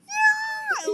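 high, loud mock shriek; imitated tantrum scream. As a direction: shriek like a small kid in a fit. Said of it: "and you're like".

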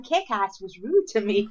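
"Kick-Ass was rude to me" said slowly and level; "Kick-Ass was rude to me" as a slow matter-of-fact statement; deadpan statement. Retold or imitated. Announcing news to everyone.

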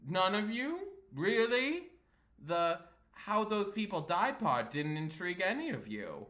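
theatrically put-out showman; flamboyant Sterling. Acted. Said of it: "he's kind of, like, flamboyant".

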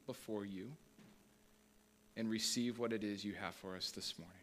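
soft, hushed prayer to open the service; quiet prayer. Acted. Pastor praying softly before the congregation, hushed. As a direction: pray gently and humbly.

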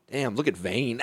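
usual speaking voice

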